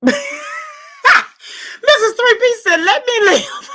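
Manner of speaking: high squealing laugh through the words; barely getting it out for laughing; laughing hard while reading aloud. Real, not acted. Cracking up at a comment.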